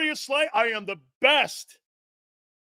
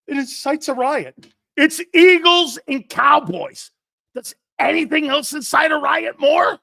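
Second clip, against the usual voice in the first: excited shout; 9 semitones higher, 9 dB louder than usual. Real, not acted. Host shouting to co-host about Eagles-Cowboys, excited shout. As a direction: yell it like the rivalry is sacred.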